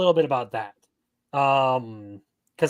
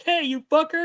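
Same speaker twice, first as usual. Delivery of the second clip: friendly yell; 11 semitones higher than usual. Retold or imitated. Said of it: "like yelling at your homie from across the street".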